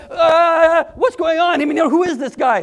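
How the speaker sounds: loud, high, fast flustered voice; hammed-up confused bystander; bewildered crowd. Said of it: "People are running and going".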